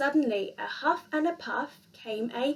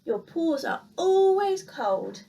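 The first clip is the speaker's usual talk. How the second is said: gruff refusal; 4 semitones higher, 3 dB louder, slower than usual. A narrator's reading. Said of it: "said Big Mouse".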